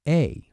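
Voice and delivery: "a" said like the ay in eight; teacher demo. Shown as a demonstration. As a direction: give "a" as the vowel of eight. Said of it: "as in the word eight".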